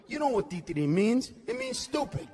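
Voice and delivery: ordinary talk, nothing marked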